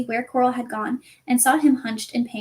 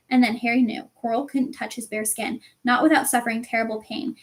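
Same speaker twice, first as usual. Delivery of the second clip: ordinary delivery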